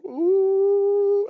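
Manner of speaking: high swooping "ooh" like a crooked line; squealing mock swerve noise; wobbly line sound. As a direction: mimic a line veering off. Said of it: "like that".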